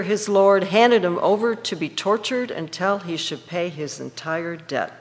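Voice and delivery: ordinary talk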